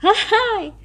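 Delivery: a big high laugh, loud and open; loud hearty laugh. A narrator's reading. Tortoise laughs loudly at Bamboo's reason, a big high laugh. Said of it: "The tortoise laughed loudly".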